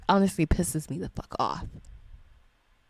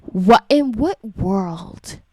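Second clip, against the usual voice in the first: slow, drawn-out incredulous question, louder; indignant disbelief; 5 dB louder, slower than usual. Real, not acted. Parent outraged about students touching a teacher, slow and indignant. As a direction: draw it out in outrage.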